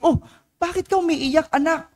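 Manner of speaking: high, concerned motherly voice; a mother startled to find her child crying, high and concerned; worried mother. Retold or imitated. Priest voicing a worried mother asking her crying child, high pitched.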